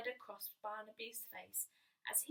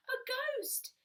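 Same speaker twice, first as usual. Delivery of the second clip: frightened cry; 12 semitones higher, 4 dB louder than usual. Acted. Little Bear crying out again at the ghost. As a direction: cry it again, still scared. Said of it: "he cried".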